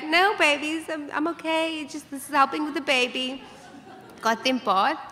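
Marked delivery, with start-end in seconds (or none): 0.0-3.4 put-on higher reassuring mom to kids; bright reassurance. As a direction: brightly cover the pain for the kids. Reassuring kids mid-contraction.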